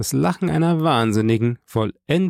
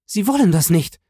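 high, horrified woman's outburst; high cry of disbelief; acted cry; 9 semitones higher, 4 dB louder than usual. A narrator's reading. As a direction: cry it out desperately, pitched up. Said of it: "schrie sie".

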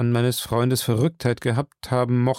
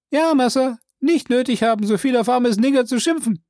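high, loud servant voice calling down; Jupiter's voice; 11 semitones higher, 3 dB louder than usual. Acted. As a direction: pitch him well up and call out. Jupiter complains.

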